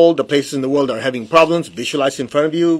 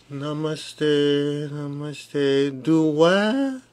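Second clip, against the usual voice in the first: mock lazy meditator; 6 dB quieter, much slower than usual. Lazy meditator avoiding exercise.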